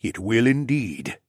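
slow, measured reassurance; rumbling Dino Man. A narrator's reading. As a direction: lightly rumble it with dignity. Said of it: "rumbled in his deep voice".

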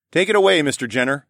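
loud, bright invitation; playful announcing. Acted. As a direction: hand him the stage. Inviting the performer.

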